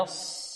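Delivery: يص whispered with a thick unrounded ص; whispered emphatic sad. Shown as a demonstration. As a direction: breathe out يص, heavy ص, lips spread. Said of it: "نفخم الصاد بدون ضم الشفتين".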